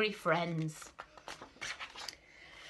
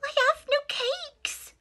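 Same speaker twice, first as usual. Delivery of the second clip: very high little rabbit voice; timid rabbit. Acted. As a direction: squeak it like a small scared rabbit. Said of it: "the rabbit replied".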